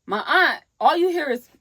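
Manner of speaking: ordinary delivery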